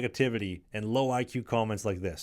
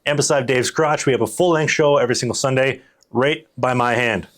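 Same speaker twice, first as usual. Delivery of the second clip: loud, fast channel plug; promo read; 2 semitones higher, 10 dB louder than usual. Acted. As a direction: pitch the clips channel hard.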